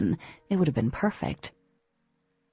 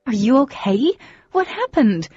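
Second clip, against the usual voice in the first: higher and louder, breathless worry; frantic; 7 semitones higher, 6 dB louder than usual. A narrator's reading. Girl grabbing the boy she thought drowned, frantic. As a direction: clutch at him, desperate to know.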